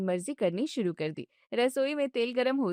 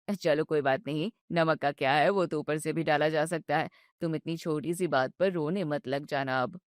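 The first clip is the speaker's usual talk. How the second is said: lower, gentle husband voice; calm her down patiently; soothing husband; 7 semitones lower than usual. Acted. Husband soothes his upset wife, low and gentle.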